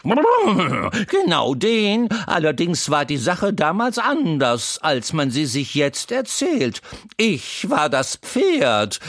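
put-on a chatty horse teasing the crying boy; Troubadour the talking horse. A narrator's reading. Horse Troubadour comforting the crying boy Wendel, high and loud.

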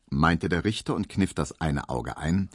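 plain reading voice

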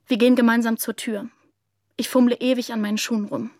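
plain reading voice

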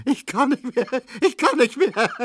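very high, squealed gasps of laughter; helpless laughter. A narrator's reading. Neumann doubled up over the news, helpless laughter. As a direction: cry it out, overcome with laughing. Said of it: "Neumann lachte, dass die Scheiben zitterten".

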